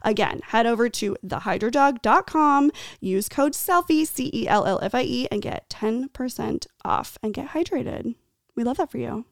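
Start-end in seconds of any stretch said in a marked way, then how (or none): 0.0-8.2 upbeat, emphatic promo read. Acted. Podcast host urging listeners to use a discount code, ad read. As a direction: sell it clearly, spelling out the code.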